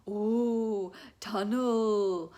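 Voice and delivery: loud, hollow-tunnel style "ooh"; playful booming tunnel call; changed voice. Shown as a demonstration. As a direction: put on a big voice and stretch the words. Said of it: "when I change my voice like that".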